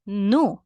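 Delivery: "Nu" by itself, loud and emphatic; stressed syllable alone. Shown as a demonstration. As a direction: punch out "Nu" as the strong syllable. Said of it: "is the strongest part of the word".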